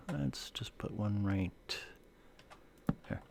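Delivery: whispered aside to himself. Real, not acted. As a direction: whisper it as you place the piece. Streamer placing item, muttering aside.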